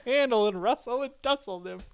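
high-pitched mock Texas accent; Texan robot. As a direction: play the Texan cowhand through laughter. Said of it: "It's a Texan".

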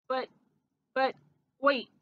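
halting, broken-up protest; stop-start stammer with gaps between words; sputtering. A narrator's reading. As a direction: sputter it out word by word. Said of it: "sputtered Freddie".

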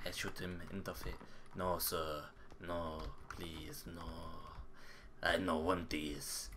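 low, very soft voice; mock meek pleading. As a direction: plead softly as a reluctant fighter. Acts reluctant pleading during fight.

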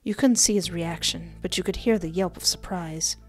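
ordinary talk

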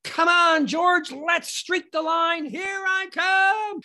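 high, slow, drawn-out holler; rally a lagging friend at a shout; yelling back. Acted. Streaker to George lagging behind, yelling back. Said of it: "I yelled back at George".

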